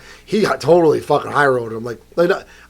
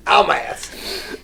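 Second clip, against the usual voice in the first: cartoon voice; 4 semitones higher, much slower than usual. Cartoon voice continues.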